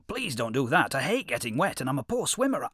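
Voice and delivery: rising high, loud pleading; sly yippy jackal. A narrator's reading. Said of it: "said the jackal".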